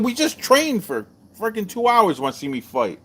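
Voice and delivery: mock outrage at a friend; exasperated. Retold exasperation at Bill.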